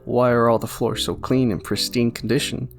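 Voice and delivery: normal talking voice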